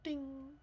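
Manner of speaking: small high chime sound; a soft high little ding; tiny ding. Retold or imitated. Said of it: "a teeny tiny little".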